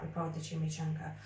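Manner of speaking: usual speaking voice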